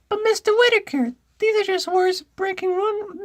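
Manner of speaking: very high squeaky schoolboy; loud, high-pitched boy character. Acted. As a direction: protest to a teacher in piercing falsetto. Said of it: "I gotta do a boy voice".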